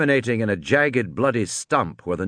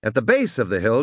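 ordinary delivery, nothing marked

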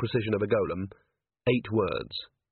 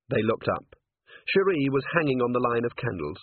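ordinary delivery, nothing marked

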